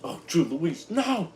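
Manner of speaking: high loud playful scolding; mock outrage. Real, not acted. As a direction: scold a friend in jest. Reacting to a chat comment.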